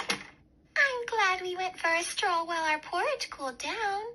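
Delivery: high little cub voice; small happy bear cub; Baby Bear chatting to his parents. A narrator's reading. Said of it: "said Baby Bear".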